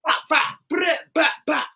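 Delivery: belt-hit sounds. Retold or imitated. Mimicking belt hits.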